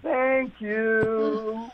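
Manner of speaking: high, drawn-out mock-sweet thank you; comically sustained, singsong gratitude; exaggerated thanks. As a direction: stretch it out high, as if thanking someone who wronged you. Thanking someone who slammed the door.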